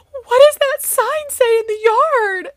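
put-on loud, very high, drawn-out child's demand; upset child. Daughter spotting the sign in the yard, horrified high yell. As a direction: imitate a kid wailing a horrified question, slowly.

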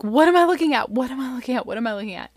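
mild panicked disbelief, replayed; retold alarm. Mom reacting to blood everywhere, retold alarm.

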